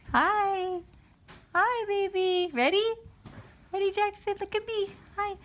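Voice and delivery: put-on high singsong coo to baby; high baby talk. Dad coaxing baby Jackson to look, high coo. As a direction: coo up high to catch his eye.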